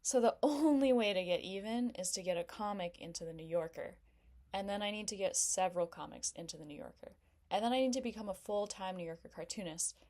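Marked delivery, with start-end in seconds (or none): 4.5-10.0 escalating rush. Acted. Comic rushes through her revenge plan, speeding up. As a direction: pile each goal on faster and faster.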